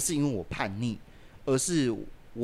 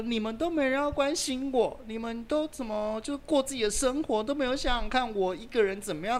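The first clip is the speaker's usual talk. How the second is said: mimicking mother; 12 semitones higher than usual. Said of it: "他说".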